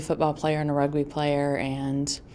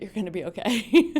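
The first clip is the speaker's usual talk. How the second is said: a real laugh breaking through the line; laughing as the words come out; 5 semitones higher, 7 dB louder than usual. Real, not acted. Mother retelling the minister's words to her daughter, laughing. Ends retold quote laughing.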